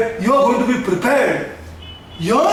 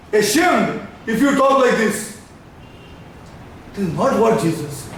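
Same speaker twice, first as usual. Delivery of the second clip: usual speaking voice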